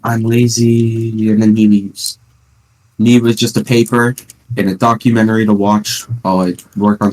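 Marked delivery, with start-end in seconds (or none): 0.0-1.3 very slow, dragged-out lazy line; mock lazy teacher; lazy drawl. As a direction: stretch it out like you can't be bothered. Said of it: "He's like".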